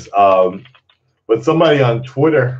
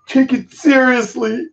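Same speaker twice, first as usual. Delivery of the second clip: mock commenter; 14 semitones higher, 4 dB louder than usual. Mocking the commenter's line.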